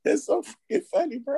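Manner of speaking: high, laughing voice. Real, not acted. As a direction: squeeze it out while laughing. Laughing hard at the joke.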